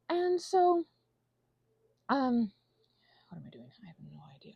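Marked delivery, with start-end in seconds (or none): 3.3-4.5 breathy aside under her breath; whisper. Real, not acted. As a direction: whisper it to yourself. Whispering while painting alone.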